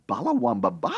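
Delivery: high, rising, baffled question; incredulous echo. Retold or imitated. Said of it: "And you think".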